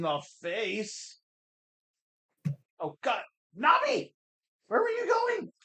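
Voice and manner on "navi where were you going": high-pitched cry at a companion; shrill-pitched yell of complaint; exasperated shout. Real, not acted. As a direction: call out in high, exasperated protest. Calling out to game companion.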